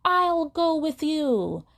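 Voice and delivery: high, drawn-out retold yell; mock scream. Assistant declaring she will go with him, mock scream. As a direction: belt it out slowly, imitating her scream. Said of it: "she screamed".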